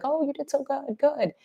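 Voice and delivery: high, soft praise to a dog; half-hearted praise. Acted.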